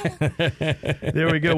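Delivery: laughing through the words; drawn out with a laugh. Real, not acted. Laughing after co-host's joke.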